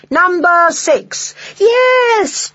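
high, drawn-out cheer; big whooping celebration; excited cry. A narrator's reading. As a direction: shout it high like thrilled children. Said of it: "cried the children".

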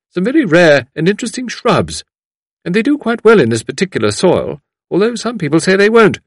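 high, loud fussy lady voice; gushing visitor. A narrator's reading. Visitor showing off her gardening book, gushing. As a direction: gush eagerly and apologetically.

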